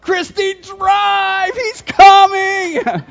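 high, loud frantic scream; desperate shouted warning to his daughter; panicked yell. Retold or imitated. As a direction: scream it as if a bear is on your heels. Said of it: "I started screaming and yelling".

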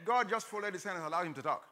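normal talking voice

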